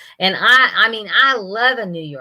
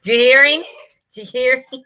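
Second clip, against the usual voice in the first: higher, tickled laughing question; 6 semitones higher, 4 dB louder, faster than usual. Real, not acted. Grandma delighted by her grandson's accent bit, laughing. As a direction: say it through real laughter.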